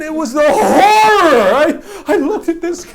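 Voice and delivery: drawn-out, loud cry of horror; hammed-up horror. Man retelling his boyhood shock at a bad prize, mock horror.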